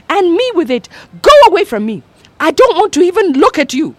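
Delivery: high, loud scolding mother; a mother berating her daughter, shouting; furious Mama. A narrator's reading.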